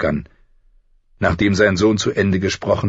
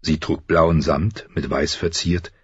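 plain reading voice

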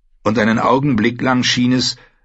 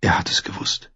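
soft, voiceless thought; whispered realisation; 5 dB quieter than usual. Acted. Young man realises beside the dead ranger, whispering. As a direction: whisper it to yourself.

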